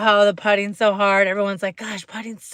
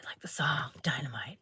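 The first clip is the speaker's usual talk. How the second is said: whisper; 7 semitones lower, 14 dB quieter than usual. Shown as a demonstration. Whispering the song title.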